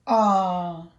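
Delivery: ordinary delivery, nothing marked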